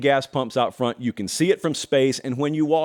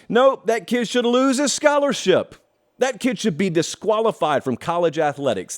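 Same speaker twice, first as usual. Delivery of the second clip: mock outraged critic; 7 semitones higher than usual. Mocking an outraged tweeter.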